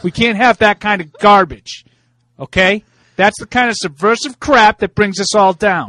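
put-on loud, high preachy Boston accent. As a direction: scold them in a Boston accent. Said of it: "are you affecting an accent there".

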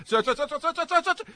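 rapid high sirs like a tobacco auctioneer; auctioneer rattle. Retold or imitated. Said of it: "a tobacco auctioneer".

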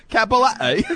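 high laughing Cappelletti, mimicking his accent; laughing mock-accent Cappelletti; laughing imitation of the accent. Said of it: "Your accent put that over the top".

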